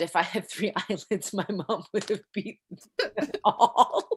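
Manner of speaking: quoting a joke, breaking into laughter; laughing. Retold or imitated. Coach retelling her favourite absurd joke, laughing. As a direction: let the laugh take over by the end.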